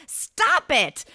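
loud, high protest; crying out. A narrator's reading. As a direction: shout to make him stop. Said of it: "I cried".

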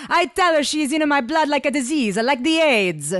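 loud, high Italian shout; angry shouting. A narrator's reading. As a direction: bellow it back in disgust. Ivan retells roaring back.

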